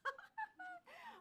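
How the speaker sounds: high, helpless laugh; real laughing fit. Real, not acted. Host cracks up at co-host's Irish accent, high laughter. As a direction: laugh uncontrollably in a high pitch. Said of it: "She's laughing over there".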